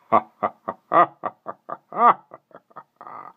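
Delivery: long, eerie chuckle; chilling laugh trailing away; soft villain laugh. A narrator's reading. Mister Hastings laughs across the water, soft and eerie. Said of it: "Mister Hastings laughed softly".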